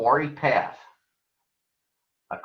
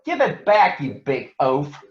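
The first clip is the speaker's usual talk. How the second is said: shouting; 3 semitones higher, 4 dB louder than usual. Acted. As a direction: shout it at the bully. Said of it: "shouted Brother".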